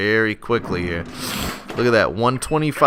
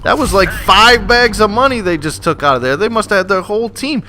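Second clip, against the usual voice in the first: indignant; 8 semitones higher, 4 dB louder than usual. Real, not acted. Raging over robbed vault.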